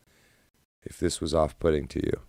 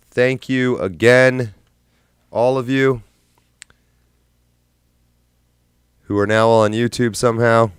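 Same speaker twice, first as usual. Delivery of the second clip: excited; 6 semitones higher, 6 dB louder than usual. Real, not acted. Loud thanks to viewers.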